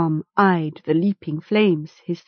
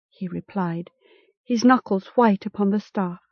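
ordinary delivery

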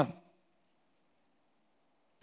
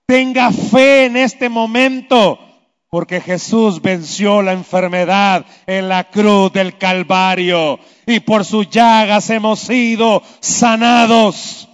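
ordinary talk

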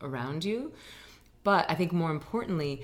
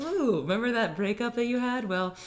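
higher, sing-song mock reminder; teasing teacher voice; 3 semitones higher, 3 dB louder than usual. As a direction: tease them playfully about what's still inside. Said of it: "and I'm like".

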